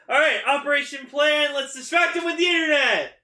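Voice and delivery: high, loud rallying shout; rally the team at full voice; excited battle cry. Retold or imitated. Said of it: "goes, like".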